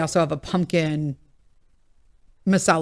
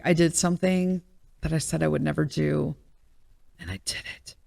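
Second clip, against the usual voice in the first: softer, dropping to a whisper on and I did it; mock-dramatic confession; 4 semitones lower, 7 dB quieter, faster than usual. Confessing to her husband about the app, hushed mock drama. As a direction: confess like it's a grave sin.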